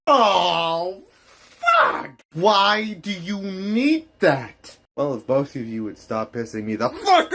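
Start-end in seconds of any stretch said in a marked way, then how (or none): 0.1-2.1 high, loud startled yelp; shocked yelp. Acted. Shocked outburst. 2.3-4.8 shocked. Acted. Shocked question, slow.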